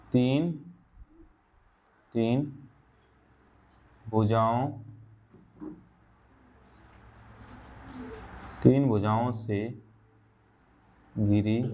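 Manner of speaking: word by word, long pauses between words; slow dictation. Shown as a demonstration. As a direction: dictate the definition slowly for notes. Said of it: "यहाँ से इसका definition लिख देते हैं". (धीरे-धीरे शब्द-शब्द बोलकर लिखवाना)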